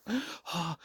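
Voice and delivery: sharp, high gasp of shock; mock breathless gasp; acted gasp. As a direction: catch your breath as if just escaped. Acting escape from the bear.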